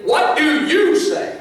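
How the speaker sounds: slow, pointed, high-pitched challenge; emphatic demand. Retold or imitated. Scribes demanding Jesus answer, slow and emphatic. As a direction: press the question hard, word by word. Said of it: "they ask him very emphatically".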